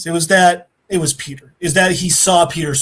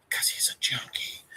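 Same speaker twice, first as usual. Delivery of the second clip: low whispered aside; hushed aside; 14 dB quieter than usual. Real, not acted. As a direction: drop to a near whisper, as an aside. Muttered aside on the character.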